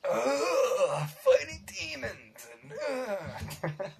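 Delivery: high, mock-pained ugh; exasperated groan. Host mock-groaning at the co-host's self-righteous priest, high and pained. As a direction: groan it out as if the memory hurts. Recalling the annoying priest character.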